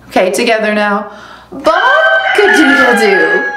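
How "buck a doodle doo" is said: slow, loud laughing crow; rooster crow. Acted. Rudy crowing at six in the morning, slow and loud. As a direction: crow at dawn with a giggle. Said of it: "At six A M".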